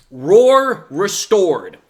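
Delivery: slow, loud title card; dramatic title announcement. Acted. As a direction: announce it like a movie chapter title.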